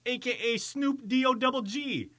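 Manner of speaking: usual speaking voice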